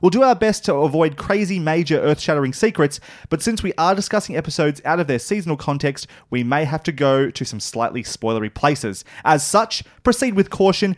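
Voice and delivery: hammed-up high announcer read; dramatic announcer. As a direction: read the warning like a trailer. Reads spoiler warning segment.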